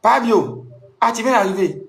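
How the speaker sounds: voix bien plus aiguë, accueil de réceptionniste imité; réceptionniste surprise. Retold or imitated. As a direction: imiter la réceptionniste qui s'exclame, plus haut. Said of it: "elle dit". (la réceptionniste accueille Fabio, voix aiguë imitée)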